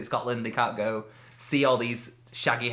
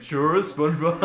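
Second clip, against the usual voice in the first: Patrick voice. Retold or imitated. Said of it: "Your voices were a lot better".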